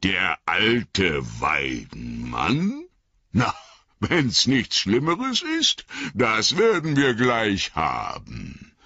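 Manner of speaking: booming, swooping old fellow, mocking the tree; jolly old Tom. Tom Bombadil mocking the old willow, booming jolly voice. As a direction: scoff and boast cheerfully, big and free.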